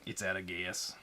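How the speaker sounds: put-on Sling Blade accent. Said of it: "Just know that I can do it".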